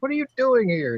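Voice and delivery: high, loud, incredulous question; startled dad, imitated. Imitating his dad to the girlfriend's dad at three a.m., startled high demand. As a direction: act out a dad confronting an unexpected visitor. Dad woken by late visitor.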